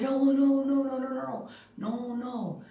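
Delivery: high, frantic repeated no, fading out; a child begging, rising high then fading; panicked no. Retold or imitated. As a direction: plead like a scared child caught out.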